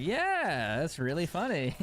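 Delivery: high, dry mock-enthusiastic yeah; sarcastic. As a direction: play it up as a sarcastic joke. Sarcastic reply to the vet.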